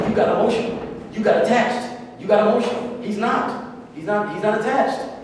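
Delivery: fired-up sermon voice; impassioned preaching. Acted. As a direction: drive the point home from the pulpit. Preaching builds loud.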